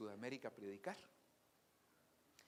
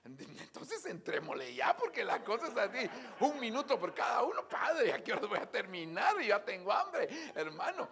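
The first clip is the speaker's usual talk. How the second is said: louder, higher, half-laughing complaint; hurried retort; 6 semitones higher, 10 dB louder than usual. Retold or imitated. Preacher telling the pastor he is already hungry, loud. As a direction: rush it like a hungry, impatient guest. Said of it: "le dije".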